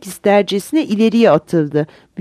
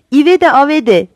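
put-on higher, louder children's call; shouting; 5 semitones higher, 5 dB louder than usual. A narrator's reading. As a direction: call out the title eagerly. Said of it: "diye bağırdı". (heyecanla bağırarak)

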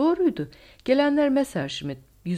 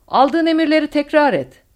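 high, very loud command; roaring order; 10 semitones higher, 12 dB louder than usual. A narrator's reading. Colonel to the fighter pilot over radio, roaring order. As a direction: roar it down the radio. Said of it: "ve kükredi".

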